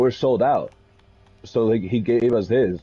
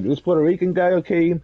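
lightly raised, playful callout; mock her on-stream remark; a hint of the streamer's playful voice; teasing streamer voice; 6 semitones higher than usual. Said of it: "She was like".

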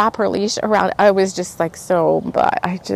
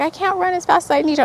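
higher, quick mock frustration; mad at self; 9 semitones higher, faster than usual. Runner mad at herself chasing the dog, frustrated. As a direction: reenact a mildly exasperated inner protest. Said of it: "I was getting so mad at myself".

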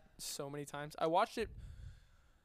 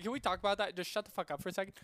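ordinary delivery

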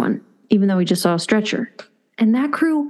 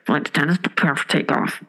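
pilot announcement; 7 semitones lower than usual. Retold or imitated. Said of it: "before the pilot's like".

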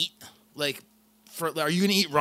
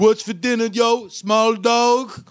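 put-on higher, taunting, poor Filipino accent; bad Filipino accent; 6 semitones higher, 5 dB louder, much slower than usual. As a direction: coworker mocking Marlon in bad Filipino accent. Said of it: "he'd be like".

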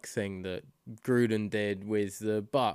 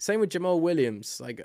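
ordinary talk, nothing marked

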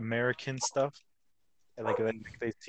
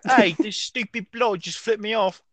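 higher, louder, slow mock Brit outrage; mock British accent; 10 semitones higher, 9 dB louder than usual. British viewer fuming at anime, hammed-up British accent. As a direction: play an offended British bloke. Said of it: "how they feel when they watch anime".

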